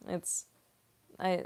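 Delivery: ordinary talk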